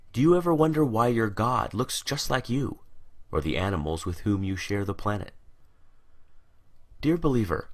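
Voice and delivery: ordinary delivery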